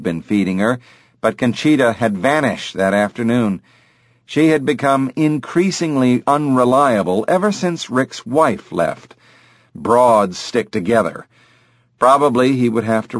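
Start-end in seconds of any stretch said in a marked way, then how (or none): none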